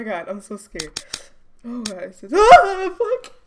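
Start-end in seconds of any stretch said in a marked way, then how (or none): 2.3-3.3 sudden loud, high yelp; startled shout. Real, not acted. Gamer jump-scared by the monster, startled yell.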